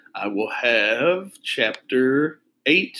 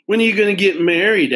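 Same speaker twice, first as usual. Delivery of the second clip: teasing; 8 semitones higher, 6 dB louder than usual. Acted. Friend teasing.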